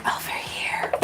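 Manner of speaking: soft breathy whisper; spirit whisper. Retold or imitated. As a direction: whisper it like a secret in someone's ear. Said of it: "whisper that in my ear again".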